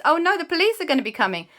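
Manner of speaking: playful alarm about the police; mock panic. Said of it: "they realized".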